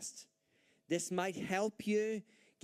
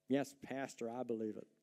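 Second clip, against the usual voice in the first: lightly imitated churchgoer, lower and softer; mock dutiful agreement; 6 semitones lower, 5 dB quieter than usual. Preacher imitating churchgoers playing the game, mildly mock-dutiful. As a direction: play along with the pastor, unconvinced. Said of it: "we all play the game".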